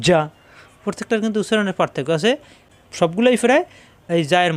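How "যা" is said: যা as a hard j pressed on the palate; palatal jim. Shown as a demonstration. Teacher demonstrating jim, যা with a firm palatal j. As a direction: press mid-tongue to the roof for যা. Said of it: "জিহ্বার মাঝখানে উপরে তালুতে চাপ দিয়ে".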